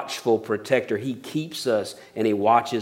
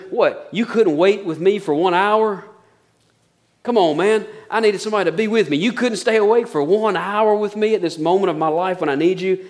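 pleading rebuke; 6 semitones higher than usual. Acted. Jesus rebukes sleeping Peter.

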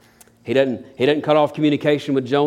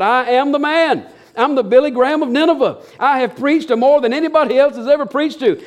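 boastful strut; 9 semitones higher than usual. Retold or imitated. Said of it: "strutting proud like a peacock".

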